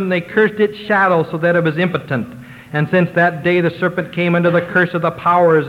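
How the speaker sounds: ordinary delivery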